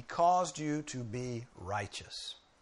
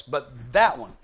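slow, loud THAT; very emphatic; 9 dB louder, slower than usual. Shown as a demonstration. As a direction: lean hard on THAT. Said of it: "a very emphatic way to say it".

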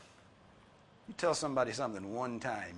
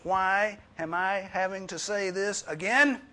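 high, loud, slow exasperated parent; 8 semitones higher, 10 dB louder than usual. Retold or imitated. Preacher imitating a parent scolding kids, loud and exasperated. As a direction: nag like a fed-up mom. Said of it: "You ever said that to your children".

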